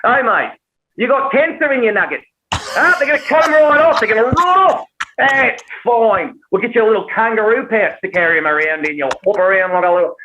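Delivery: high, hammed-up Australian accent; Australian doctor. Doctor cheerfully breaking cancer news, exaggerated Australian accent. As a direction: chirp bad news in broad Australian. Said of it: "doctor with an Australian accent".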